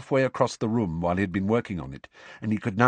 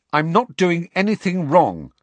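higher, louder call after her; 9 semitones higher, 7 dB louder than usual. A narrator's reading. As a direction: call out defensively. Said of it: "Harry called after her".

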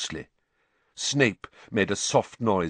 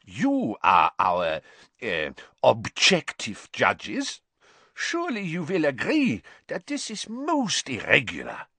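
unctuous; 5 semitones higher than usual. A narrator's reading. Karkaroff flattering the objective judges, unctuous. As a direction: coax them oilily, taking your time. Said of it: "his voice unctuous once more".

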